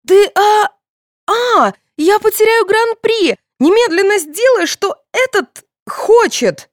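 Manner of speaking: very high, stammering, loud tantrum; screaming. A narrator's reading. Daughter afraid of losing prize, screaming at mother. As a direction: wail and order in a frantic high voice. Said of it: "завопила Соня".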